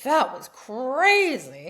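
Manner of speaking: loud, high, drawn-out exhilaration; beaming thrill. Retold or imitated. Daughter after finishing the zip line, acted beaming thrill. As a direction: stretch it out, imitating an elated kid. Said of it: "her f-, beaming".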